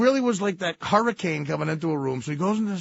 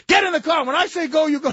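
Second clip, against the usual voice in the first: shrill command; 13 semitones higher, 6 dB louder than usual. Retold or imitated. Wife nagging, repeat.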